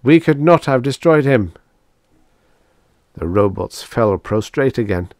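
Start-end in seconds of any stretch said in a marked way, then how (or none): 0.0-1.5 high, loud emphatic declaration; raised, ringing robot voice; exclaiming. A narrator's reading. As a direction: proclaim it as proof of faith. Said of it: "exclaimed two oh four".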